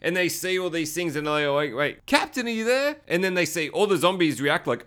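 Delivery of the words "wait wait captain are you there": high-pitched imitation of worried calling; hammed-up uneasy hail; mock anxious calling. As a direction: call out high, checking for the captain. Group calls out to the captain.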